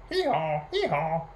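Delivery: two-note hee-haw bray; donkey braying. A narrator's reading. Storyteller voicing the donkey next morning, braying. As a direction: imitate a donkey, twice over. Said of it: "the donkey doing as donkeys do".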